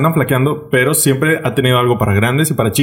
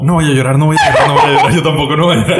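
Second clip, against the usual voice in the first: a touch louder mock self-restraint chant; louder mock vow not to cry; imitating del Toro; 3 dB louder, faster than usual. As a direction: lightly play the director fighting his tears. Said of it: "del Toro estaba así como".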